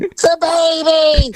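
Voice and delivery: high, slow, drawn-out wail; booming voice. Retold or imitated. Woman in labour calling from upstairs, slow and high. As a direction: stretch each word out from upstairs. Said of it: "booming voice".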